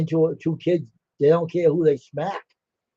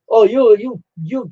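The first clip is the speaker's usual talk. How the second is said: mock indignant sputter; 5 semitones higher, 5 dB louder, slower than usual. Retold angry teacher sputtering.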